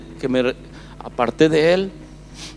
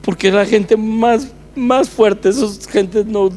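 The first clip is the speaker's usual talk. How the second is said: overwrought, tearful; 6 semitones higher, 5 dB louder than usual. Real, not acted. Still overcome after recalling the plea.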